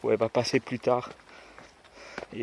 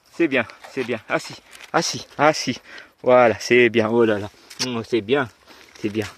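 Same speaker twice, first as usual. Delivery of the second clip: praising a dog; 7 dB louder, slower than usual. Real, not acted. Praising the dog after it obeys.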